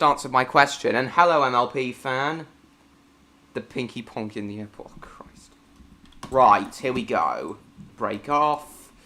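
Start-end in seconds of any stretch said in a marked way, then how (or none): 3.5-5.5 muttering under his breath; whispered. Real, not acted. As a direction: whisper it to yourself.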